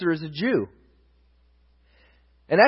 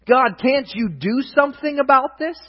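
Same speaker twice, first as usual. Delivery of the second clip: higher-pitched appeal to God; exasperated plea; 7 semitones higher, 4 dB louder than usual. Acted. Israelites to God before battle, exasperated plea. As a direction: plead upward in mock exasperation.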